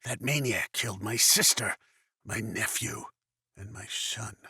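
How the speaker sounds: bitter grief. Acted. Bereaved man telling of his murdered family, bitter grief fading quiet.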